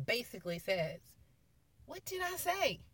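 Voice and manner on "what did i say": high-pitched, incredulous reminder; acted-out pointed question, pitched way up; exasperated rebuke. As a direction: scold them like they should have listened. Voices Jesus rebuking the disciples.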